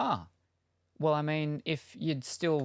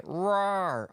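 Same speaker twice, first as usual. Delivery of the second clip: playful rawr; 6 semitones higher than usual. Pretend. Playful monster growl.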